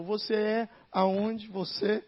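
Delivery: ordinary talk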